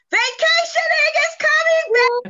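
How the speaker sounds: high-pitched, celebratory cheer; excited announcement. Real, not acted. Host reveals the big news to viewers, excited announcement. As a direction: call it out high, in jubilation.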